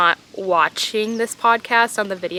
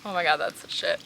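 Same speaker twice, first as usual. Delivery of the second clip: soft blissful murmur; 3 semitones lower, 8 dB quieter, faster than usual. Real, not acted. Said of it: "a moment of A S M R relaxing".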